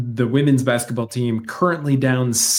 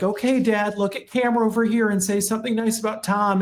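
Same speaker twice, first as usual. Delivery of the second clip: higher-pitched imitation of kids coaxing Dad; coaxing grown child; 8 semitones higher, faster than usual. Kids prompting their frail dad on camera, coaxing high voice. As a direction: mimic a family member gently prompting an old father. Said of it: "They're like".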